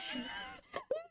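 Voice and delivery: squealing laugh breaking high; high shrieking laugh. Real, not acted. Streamer shrieking with laughter at a drawing.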